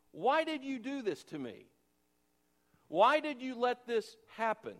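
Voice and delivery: high, pained demand of a wronged king; Pharaoh accusing. Retold or imitated. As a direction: press him, why did you do this.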